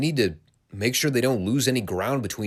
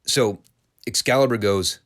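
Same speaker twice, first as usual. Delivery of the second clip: ordinary talk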